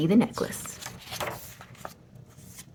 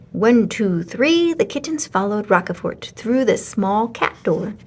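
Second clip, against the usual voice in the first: ordinary delivery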